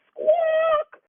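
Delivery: very high loud bird squawk. A narrator's reading. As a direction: screech it like a startled hen. A humongous squawk.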